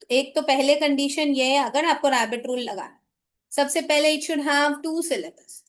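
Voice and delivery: ordinary talk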